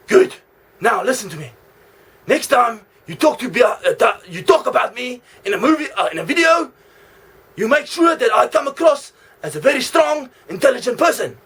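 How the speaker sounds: high, loud threatening mock Russian accent; bad Russian accent. Fake Vladimir threatening the vlogger in a loud mock Russian accent. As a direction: bark orders in a hammed-up Russian accent. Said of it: "Australian with a Russian accent".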